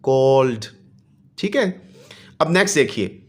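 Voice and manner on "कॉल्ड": कॉल्ड closing on a voiced "d"; ending pronounced d. Shown as a demonstration. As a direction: finish कॉल्ड with a clear "d", no extra syllable. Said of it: "third है जी डी की आवाज़". (कॉल्ड के आख़िर में साफ़ "ड")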